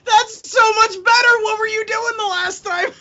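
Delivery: high, laughing disbelief. Real, not acted. Co-host reacting to the better attempt, laughing high. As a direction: squeal the words through laughter.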